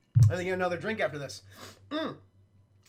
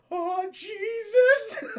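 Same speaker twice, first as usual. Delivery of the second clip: laughing mock fright; 6 dB louder, much slower than usual. Arnold startled, swinging.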